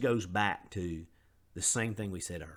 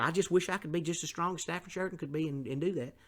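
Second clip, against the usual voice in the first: wishful listener; 7 semitones higher, faster than usual. Retold or imitated. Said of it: "some people".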